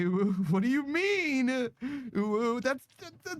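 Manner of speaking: high, breathy cutesy voice; breathy cutesy giggly; breathy cutesy ooh. Pretend. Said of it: "for me to count ooh as a word".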